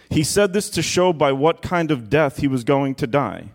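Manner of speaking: usual speaking voice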